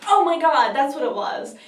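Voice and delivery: high, loud burst of remembering; sudden recollection. Real, not acted. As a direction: blurt it out as it comes back. Suddenly remembers what it was.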